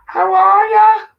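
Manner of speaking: loud, high, stretched-out greeting; excited greeting. Real, not acted. Host greets a rare visitor, high and excited. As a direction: sing out an excited, drawn-out greeting. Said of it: "I got really excited 'cause Jamie's popped in".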